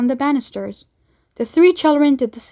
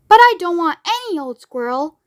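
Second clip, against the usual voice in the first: shouted; 6 semitones higher than usual. Acted. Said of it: "Veruca shouted".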